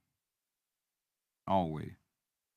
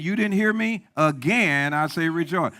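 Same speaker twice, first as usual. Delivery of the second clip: higher and louder, demanding to be heard; emphatic repeat; 5 semitones higher, 6 dB louder than usual. Acted. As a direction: press it home to the congregation. God repeating command, preacher emphatic.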